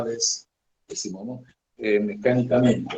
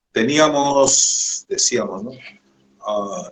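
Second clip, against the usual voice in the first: usual speaking voice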